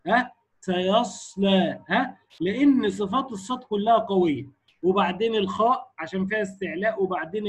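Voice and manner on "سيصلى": very slow سيصلى with a long hissing ص; strong hams. Shown as a demonstration. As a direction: hold the breath flow on the ص of سيصلى. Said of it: "أعلاها الصاد". (همس قوي في صاد سيصلى)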